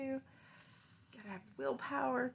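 plain reading voice

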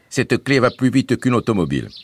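Bouzig's voice. A narrator's reading. Bouzig reassuring the family, bright boyish voice. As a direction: play the cheerful young guide Bouzig.

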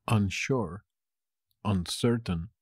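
ordinary delivery

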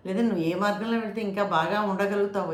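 normal talking voice

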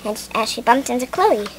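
ordinary delivery, nothing marked

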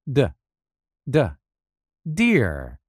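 the D sound spelled out, then deer; phonics drill. Shown as a demonstration. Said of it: "Repeat after me".

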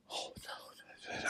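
hushed, breathy whisper of dismay; whispered alarm. Retold or imitated. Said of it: "they were like".

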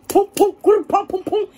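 percussive bursts of pum, punched out; imitated pounding. Storyteller mimicking smoke thudding in the chest, percussive pounding.

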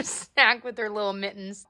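ordinary talk, nothing marked

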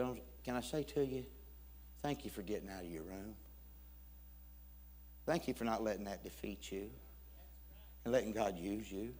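soft, low, sincere gratitude; hushed, tender retelling; heartfelt thanks. Retold or imitated. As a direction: thank someone quietly and earnestly. Stranger's heartfelt thanks retold.

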